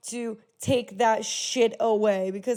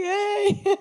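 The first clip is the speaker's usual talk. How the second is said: mock innocent greeting; 10 semitones higher, 4 dB louder than usual. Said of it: "And we'll just be like".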